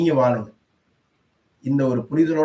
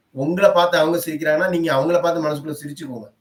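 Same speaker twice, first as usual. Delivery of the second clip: usual speaking voice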